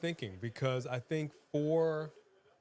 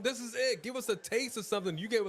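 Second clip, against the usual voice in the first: hyped; 8 semitones higher than usual. Real, not acted. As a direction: cheer it like a thrilled fan. Hyped about great show segment.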